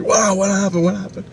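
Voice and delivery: high-pitched retold astonishment; startled questioning. Family asking what happened on the road, startled. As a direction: re-enact a baffled reaction, pitched well up.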